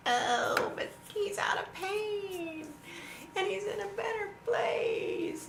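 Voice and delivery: very high, slow, whiny mock sympathy; mocking condolences. Mocking what everybody said when her dad died, high and whiny. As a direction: parody a syrupy condolence. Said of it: "everybody was like".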